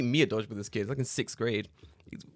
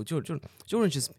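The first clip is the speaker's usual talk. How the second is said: usual speaking voice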